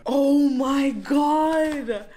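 slow stretched-out gasp of joy; drawn-out excited. Real, not acted. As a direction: stretch it out in delight. Unboxing excitement.

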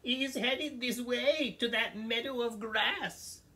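very high, squeaky goat voice; squeaky little goat. Acted. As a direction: plead in a tiny squeak.

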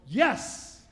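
loud high cheer; triumphant yes. Acted. Men reading Genesis cheer Adam on, loud triumphant cheer. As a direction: cheer like the men found their alibi.

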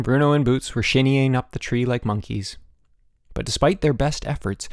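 ordinary delivery, nothing marked

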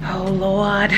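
ordinary talk

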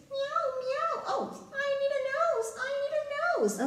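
tiny, soft, very high kitty voice; squeaky little meowing cat, asking softly. Pretend. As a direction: plead quickly as a small cat. Voices the cat lacking a nose.

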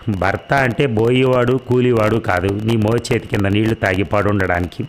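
ordinary delivery, nothing marked

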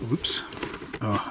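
ordinary talk, nothing marked

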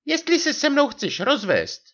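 very high martyred wife voice; ublížená manželka. A narrator's reading. Wife threatens, acting martyred. (ublížený vysoký hlas manželky)

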